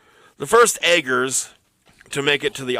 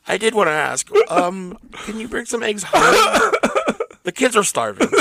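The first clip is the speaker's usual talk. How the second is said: spouse voice; 7 semitones higher than usual. Pretend. Playing a spouse.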